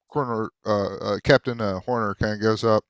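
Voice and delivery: ordinary talk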